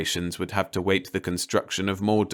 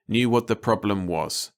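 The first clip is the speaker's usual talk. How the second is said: ordinary delivery, nothing marked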